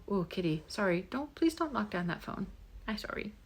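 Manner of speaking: quiet plea to the kitty; hushed plea. Real, not acted. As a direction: hush the cat away from the phone. Quietly scolding the cat.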